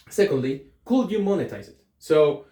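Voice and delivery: ordinary talk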